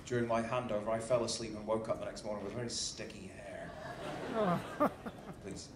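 ordinary delivery

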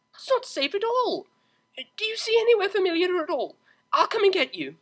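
urgent worry; Octavia. Acted. Octavia worried.

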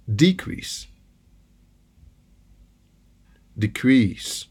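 decrease stressed on DE; noun stress on DE. Shown as a demonstration. Teacher showing the noun decrease, stress on DE. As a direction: put the weight on DE in decrease. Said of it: "nou ap pran dezyèm mo a".

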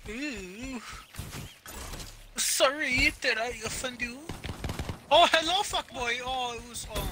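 taunting falsetto; sing-song taunt to the enemy; mocking high voice.

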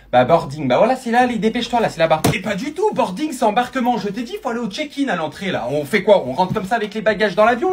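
loud, exasperated airport squabble; loud bickering. Acted. Couple arguing at the airport about boarding, loud and annoyed. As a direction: snap at each other over the check-in.

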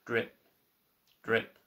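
low, soft drip, drip; a low, soft tapping drip; dripping tap. Acted. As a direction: let each drip fall softly. Leaky tap noise.

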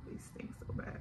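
hushed whisper. Real, not acted. Telling viewers the vitamins stink, whispered. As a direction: whisper it like a secret. Confiding about the vitamin smell.

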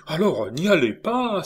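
cry out. A narrator's reading. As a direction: blurt it out in alarm. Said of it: "s'écria Schwartz".

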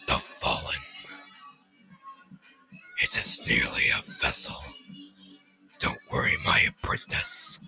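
soft, whispery ancient villain; voiceless rasping whisper; The Fallen. Acted. The Fallen soothes his apprentice, sinister whisper. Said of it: "I kinda like the Fallen".

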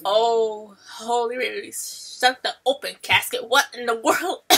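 ordinary talk

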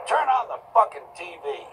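crying out. Acted. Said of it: "cried Percy".

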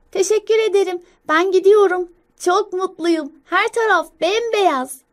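put-on high, loud, happy childlike voice; joyful child squirrel. Young squirrel leaving for school in snow, high joyful voice. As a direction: call out happily like an excited child. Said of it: "neşeli gülümseme".

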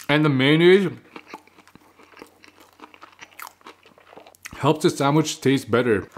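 chewing while talking; muffled by food; mouth full. Real, not acted. Seen 3: Bit at -5 s; chewing, cheeks puffed through labelled words.

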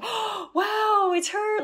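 high, hushed amazed oh wow; awed oh wow. Retold or imitated. Father and daughter seeing a butterfly, amazed and high. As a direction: marvel at the butterfly.

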